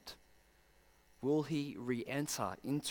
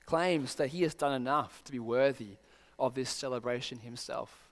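ordinary talk

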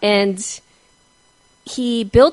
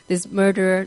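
ordinary talk

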